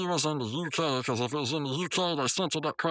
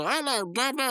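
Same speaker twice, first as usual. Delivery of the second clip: taxi cab voice; 9 semitones higher than usual. Retold or imitated. Retelling taxi cab's creepy greeting.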